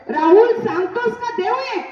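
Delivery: loud, high furious yell; angry teacher yelling at the silent pupil; angry shout. Acted. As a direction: yell at the boy to confess. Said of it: "बाई जोरात ओरडल्या".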